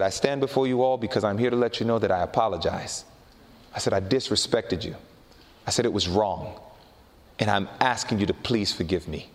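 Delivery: low, subdued and contrite; humble apology. Retold or imitated. Preacher asking the elders for forgiveness, humbly contrite.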